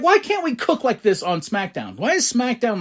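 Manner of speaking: high, pleading mock lament; exasperated complaint. Fan lamenting SmackDown beside Raw, high exasperation. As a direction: pitch it up in fan frustration.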